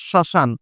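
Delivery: শ্মশান said as shoshan, ম silent, nasalised; শ্মশান: skip the ম, nasal first vowel; pronunciation demo. Shown as a demonstration. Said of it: "এটার উচ্চারণটা হচ্ছে". (শ্মশান উচ্চারণ, ম বাদ দিয়ে)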